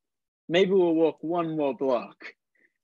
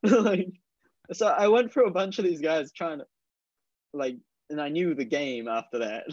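ordinary talk

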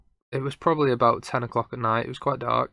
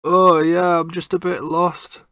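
higher-pitched imitation of a lost stranger; stranger's voice; 6 semitones higher, slower than usual. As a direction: lightly put on another man's answering voice. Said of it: "we hear this other voice come back saying".

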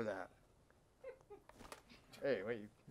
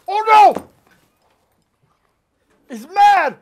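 loud, much higher cry; mock alarm; 11 semitones higher, 12 dB louder than usual. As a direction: ham up the panic. Guest gets angry at host.

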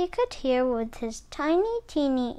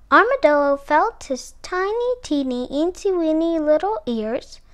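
ordinary delivery, nothing marked

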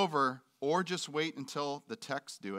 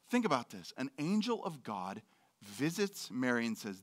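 usual speaking voice

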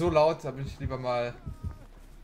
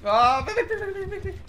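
frantic high cry, louder; panicking gamer; 9 dB louder than usual. Real, not acted. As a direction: yelp and flee in panic. Killer near, fleeing. (panischer Gamer)

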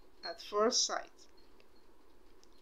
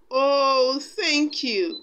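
grateful cry; 4 semitones higher, 5 dB louder, much slower than usual. A narrator's reading. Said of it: "Cinderella cried".

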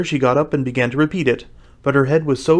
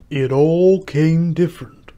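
slow, louder, drawn-out musing; thoughtful; 4 semitones higher, 5 dB louder, slower than usual. A narrator's reading. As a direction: repeat it slowly, pondering each word. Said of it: "the Mock Turtle repeated thoughtfully".

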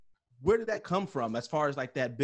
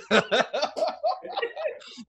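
long high giggling laugh; high-pitched laugh; 4 dB louder than usual. Real, not acted. Laughs at his bad Denzel.

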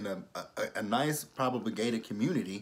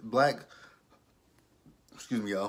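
usual speaking voice

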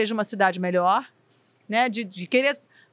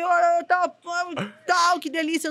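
high-pitched put-on relish; faked enjoyment; 6 semitones higher than usual. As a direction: pretend on air that it is delicious. Faking enjoyment on air.

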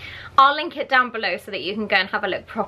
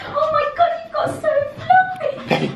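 excited pet squeal. Real, not acted. Owner greets her dog after days away, excited squeal. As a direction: squeal with delight at a fluffy pet. Gushing over the dog at reunion.

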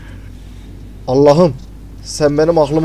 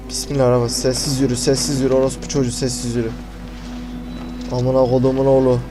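low, soft, tense self-coaching; hushed muttering; 3 semitones lower, 7 dB quieter than usual. Real, not acted. Gamer sneaking past the monster, telling self to walk silently, hushed muttering. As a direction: keep it down so nothing hears you.

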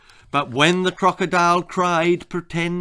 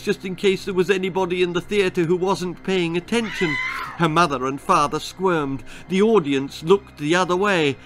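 usual speaking voice

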